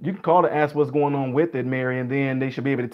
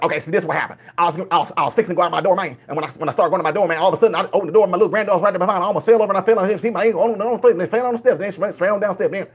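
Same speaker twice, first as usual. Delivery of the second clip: put-on rapid, loud, higher Southern accent; fast Southern dialect; 4 semitones higher, 4 dB louder, faster than usual. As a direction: tumble breathlessly through it in a Southern accent. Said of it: "The first Southern person to come in".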